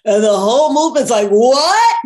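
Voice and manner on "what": loud, high-pitched astonished "What?". Retold or imitated. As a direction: blurt it out as a whole crowd's shock. Retelling everyone's astonishment at a hug.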